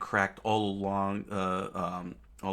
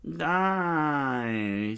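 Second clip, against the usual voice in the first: scream; 7 semitones higher, 4 dB louder than usual. Acted. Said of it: "she screamed".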